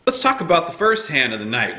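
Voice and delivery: ordinary talk, nothing marked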